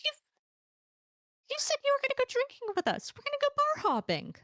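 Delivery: very high, fast whining complaint; whiny voice. Acted. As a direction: whine like a let-down drinking buddy. Heels whining they're leaving.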